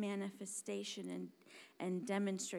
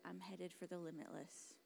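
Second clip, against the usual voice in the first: soft, low, tentative delivery; unconvinced; 3 semitones lower, 12 dB quieter than usual. Shown as a demonstration. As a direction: say it quietly, without conviction. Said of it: "like it wasn't a question mark".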